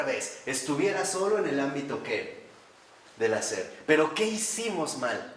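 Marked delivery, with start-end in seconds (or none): none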